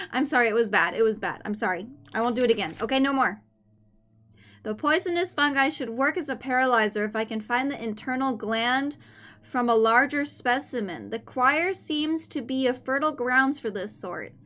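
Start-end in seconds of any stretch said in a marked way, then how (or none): none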